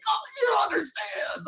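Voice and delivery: very high, half-laughing cry of despair; laughing wail. Retold or imitated. Laughing at the absurd referral.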